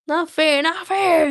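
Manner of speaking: high, loud hissing protest; Gollum hissing. Acted. Gollum protests the unfair riddle, loud and high. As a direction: spit it out like an outraged Gollum. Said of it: "he hissed".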